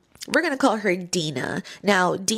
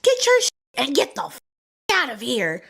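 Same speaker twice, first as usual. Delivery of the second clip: high mock yell telling the sister to get out; mock angry order; 11 semitones higher than usual. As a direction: imitate a bossy woman throwing someone out. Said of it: "She's like".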